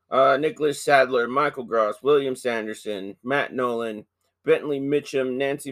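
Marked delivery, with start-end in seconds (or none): none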